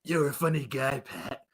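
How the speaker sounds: a hint of a calm, creepy killer; creepy calm impression. Mimicking a British friend to his cohost with a faintly creepy calm. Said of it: "sounds like some serial killer".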